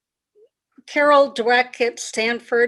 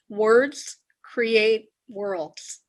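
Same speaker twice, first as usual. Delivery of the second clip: very slow, word by word; measured, weighty pacing; deliberate maxim; much slower than usual. Real, not acted. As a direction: spell out the key idea with gaps between words. Guest sets up key maxim.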